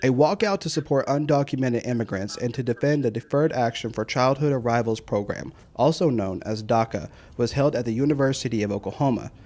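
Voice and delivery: steady anchor-style report; news read. Acted. Voice-over of news story.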